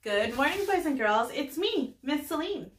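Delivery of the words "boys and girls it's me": usual speaking voice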